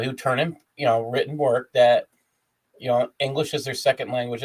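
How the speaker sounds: usual speaking voice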